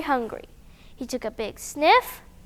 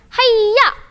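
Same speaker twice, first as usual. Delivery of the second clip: high loud martial-arts yell; karate shout; 8 semitones higher, 9 dB louder than usual. Acted. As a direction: strike with a sharp battle cry. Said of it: "and shouted".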